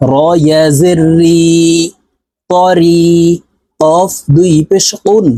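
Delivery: ordinary delivery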